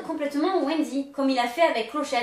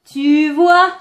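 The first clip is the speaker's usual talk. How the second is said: loud taunt; 12 dB louder than usual. Retold or imitated. Quoting Peter loudly.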